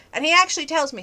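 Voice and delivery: normal talking voice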